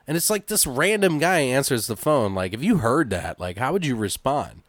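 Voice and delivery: ordinary delivery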